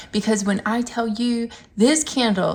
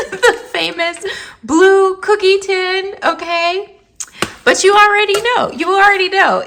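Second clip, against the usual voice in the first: higher, louder, hyped-up showing off; excited reveal; 9 semitones higher, 6 dB louder than usual. Real, not acted. Vlogger revealing the blue cookie tin, loud and excited. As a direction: sell the joke big.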